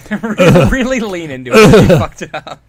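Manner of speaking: loud laughing roast; real laughing mockery; laughing tease. Real, not acted. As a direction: shout it through laughter. Roasting teasing co-host laughing.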